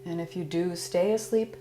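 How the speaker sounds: calm, soothing Yoga Nidra guidance; guided meditation. Acted. Teacher guiding listeners through Yoga Nidra, calm meditation voice.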